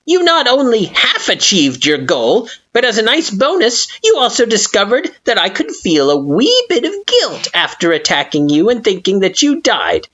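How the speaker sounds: biting sarcasm. Acted. Flowey continuing sarcastically.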